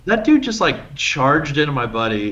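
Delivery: high-starting, mildly indignant retelling; indignant protest. Retold or imitated. Friend defending his buddy to the bouncer, indignant. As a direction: argue your buddy's case, pitch up.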